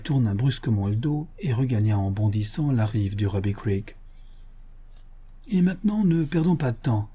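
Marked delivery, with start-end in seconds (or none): 5.5-7.1 high-pitched narrated rallying cry; rally the riders without delay; urgent exclamation. A narrator's reading. Buffalo Bill hurrying his companions along, urgent exclamation. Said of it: "s'écria Buffalo Bill".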